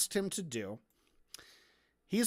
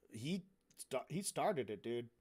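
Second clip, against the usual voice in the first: low, soft mumbled defence; acted, hushed self-justification; sheepish excuse; 7 semitones lower, 9 dB quieter than usual. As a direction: offer a feeble excuse under your breath. Sheepish excuse of the hypothetical attacker.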